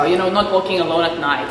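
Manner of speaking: ordinary talk, nothing marked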